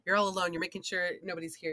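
ordinary delivery

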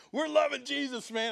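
high, loud burst of youthful zeal; hammed-up fired-up young worship leader; young zealous worshipper.

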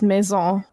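Maison pronounced the French way; French pronunciation. Shown as a demonstration. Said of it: "the French way, I believe, is".

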